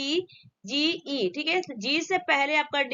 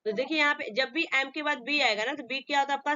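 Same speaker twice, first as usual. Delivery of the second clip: ordinary talk, nothing marked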